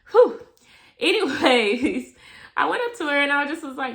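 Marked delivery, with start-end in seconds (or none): 0.1-0.4 loud exhale whew. Real, not acted. Exclamation after saying.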